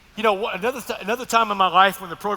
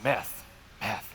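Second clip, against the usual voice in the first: much lower and softer, reluctant confession; shamed admission; 9 semitones lower, 10 dB quieter than usual. Retold or imitated. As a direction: say it low, barely wanting to admit it. Admitting his real drug.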